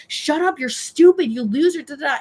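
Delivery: imitated tirade, high and loud; mock angry retort. Mimics angry man insulting commenter.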